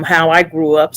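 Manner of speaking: ordinary delivery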